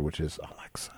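hushed aside so the speaker won't hear; whisper. Real, not acted. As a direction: drop to a whisper for the name. Avoids triggering the voice assistant.

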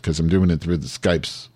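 ordinary delivery

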